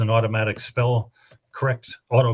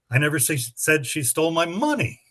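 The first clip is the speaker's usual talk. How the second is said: "money" pitched up at the end, then falling; stress on money; 3 semitones higher than usual. Shown as a demonstration. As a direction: save the punch for money. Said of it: "read that sentence with seven different emphases".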